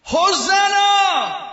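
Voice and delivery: long, high, loud cry; full-throated slow shout; shouted hosanna. Shown as a demonstration. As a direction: cry it out like a whole crowd. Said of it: "When all the adults shouted".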